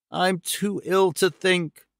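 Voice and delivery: slow, faint sick voice; barely managing the words; a dying man too weak to think. Acted. Dying Holmes pleads.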